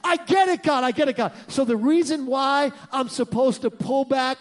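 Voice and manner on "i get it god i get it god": gleeful believer thinking God takes revenge; mock elation. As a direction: cheer at God like a teammate. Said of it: "you're like".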